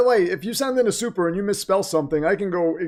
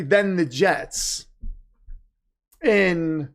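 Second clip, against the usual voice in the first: slow reading of donation message; much slower than usual. Shown as a demonstration. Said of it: "So I'll say".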